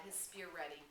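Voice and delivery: usual speaking voice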